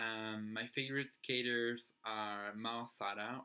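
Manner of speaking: ordinary delivery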